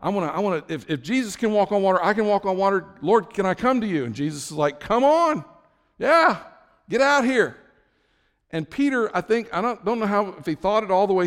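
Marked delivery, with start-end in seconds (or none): 0.0-4.0 eager, raised voice tripping over itself; Peter, excited. Acted. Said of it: "he's so excited, he's like". 4.8-7.6 high, loud, hearty beckoning shout; calling out. Acted. Jesus calls Peter onto water.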